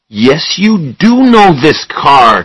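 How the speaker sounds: insisting hotly that he knows the car; angry. Acted. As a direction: press him hard, voice climbing in anger. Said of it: "Charlie said angrily".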